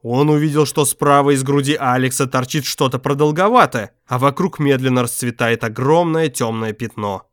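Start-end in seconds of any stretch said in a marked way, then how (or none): none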